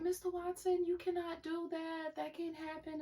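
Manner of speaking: mock-frantic principal pleading with the dad; imitating principal. Principal pleading with the angry father, high and fading. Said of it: "the lady was like".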